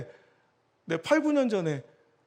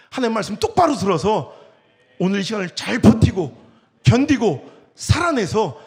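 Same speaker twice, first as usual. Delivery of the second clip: fervent preaching; 9 dB louder than usual. Real, not acted. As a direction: drive each phrase home to the congregation. Preacher exhorting congregation.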